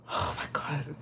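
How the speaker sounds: hushed, whispered reaction; whispered dread. Retold or imitated. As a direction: whisper it under your breath. Retells reaction to clowns' chainsaws.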